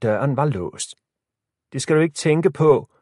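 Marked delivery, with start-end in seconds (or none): 1.7-2.9 raised, higher voice; calling back. A narrator's reading. Lisa calls back to her locked-in sister, voice raised. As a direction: call back firmly through a door. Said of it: "råbte Lisa tilbage".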